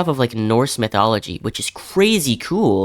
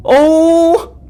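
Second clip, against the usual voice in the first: sharp high gasp then cry; terrified gasp; 9 dB louder than usual. Real, not acted. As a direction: gasp in terror. Monster right behind.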